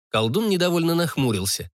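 plain reading voice

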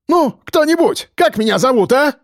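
very high, frantic demand; squealing. A narrator's reading. Frantic policeman demands his name, high squeal. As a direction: plead shrilly for an answer. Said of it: "взвизгнул Чинчаков".

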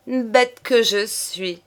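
slow, high self-reproaching cry; exclaiming. A narrator's reading. Mistress catches herself softening, crying out. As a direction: cry out at your own foolishness. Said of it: "s'écria-t-elle".

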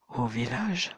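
Jérémie stammering back, taken aback; stammered. A narrator's reading. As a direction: stammer it in disbelief. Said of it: "balbutia Jérémie".

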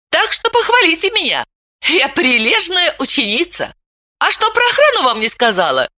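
put-on a smug teenager explaining; cocky young girl. A narrator's reading. Veta explaining herself.

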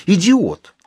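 indignant question rising to a high, slow insult; angry. A narrator's reading. Mary rebuking the medium as she stands, angry. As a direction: throw it back angrily, stretching the insult. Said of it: "Рассердившись".

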